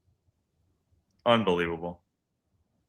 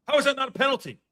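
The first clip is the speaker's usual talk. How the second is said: outraged shout; faster than usual. Real, not acted. Outrage at the referee.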